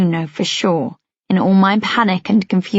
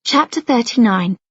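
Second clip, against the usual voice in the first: ordinary delivery, nothing marked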